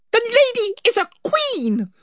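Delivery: high, proud little mouse knight; Reepicheep. Acted.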